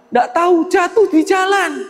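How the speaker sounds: put-on high, whiny little-child voice; whining child. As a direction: whine like a small kid who lost his money. Child answering father.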